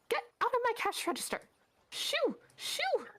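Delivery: very high, fast shooing squeal; shrieking shopkeeper woman. Acted. Woman chasing the thief from her register, very high shooing. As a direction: shoo him off in a shrill panic.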